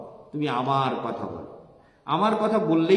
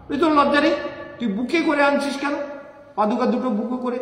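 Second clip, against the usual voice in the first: higher, louder shocked scolding; incredulous scolding; 8 semitones higher, 4 dB louder, faster than usual. Acted. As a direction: tell him off, half amused and half shocked. Nanda scolding Gopal.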